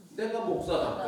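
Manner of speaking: high, firm comeback; defiant answer to an accuser; defiant retort. Retold or imitated. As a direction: fire it straight back. Pastor answers back defiantly.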